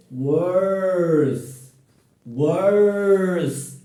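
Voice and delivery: very slow, "worse" drawn out; very slow model. Shown as a demonstration. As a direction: drag "worse" out for learners. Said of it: "Repeat after me".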